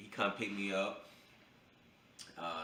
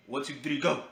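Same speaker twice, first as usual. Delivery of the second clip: rushed countdown; 4 semitones higher, 6 dB louder than usual. Retold or imitated. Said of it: "That was the fastest count in the world".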